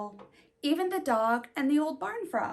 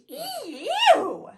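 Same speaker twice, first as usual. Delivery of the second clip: high loud squealing ew; hog squeal; 7 dB louder than usual. Acted. The sprayed hog screams, high loud squeal. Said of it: "who screamed".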